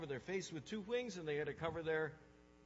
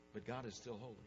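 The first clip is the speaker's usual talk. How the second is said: ordinary delivery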